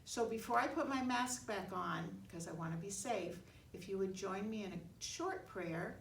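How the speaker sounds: ordinary delivery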